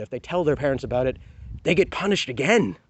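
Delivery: normal talking voice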